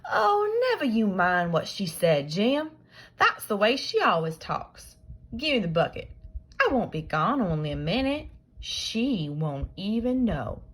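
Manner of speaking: put-on high, loud coaxing boy; Tom wheedling. Tom coaxing Jim to hand over the bucket. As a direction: sweet-talk him into it.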